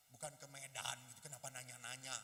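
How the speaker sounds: low, put-out reply; grumbling retort. Acted. Introvert irked by seatmate's questions, low grumble.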